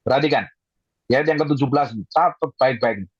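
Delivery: ordinary delivery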